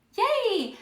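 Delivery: high cheerful yay; excited cheer. Real, not acted. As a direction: celebrate the right answer. Teacher cheering a correct answer.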